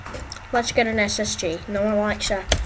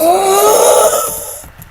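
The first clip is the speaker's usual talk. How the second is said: shocked gasp; 10 semitones higher, 6 dB louder than usual. Real, not acted. Sees ugly face in game.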